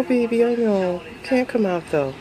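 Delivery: ordinary delivery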